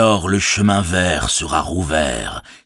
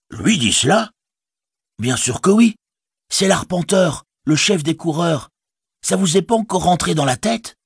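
high hobbit voice; 11 semitones higher than usual. Acted. Butterbur then Sam high voices.

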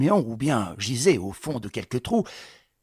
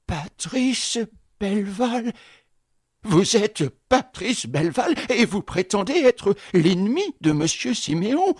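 voix aiguë, lente, ahurie; incrédule; 7 semitones higher than usual. Acted. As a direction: tombe des nues. Concierge incrédule. (le concierge incrédule devant Patrice, voix aiguë)

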